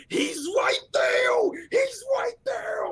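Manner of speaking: high, drawn-out frantic scream. Acted. Player screaming in character to point out the dwarf, screaming. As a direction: scream it way up high, stretching the words. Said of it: "I'm just gonna be screaming".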